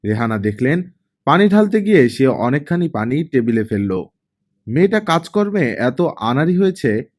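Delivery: ordinary delivery, nothing marked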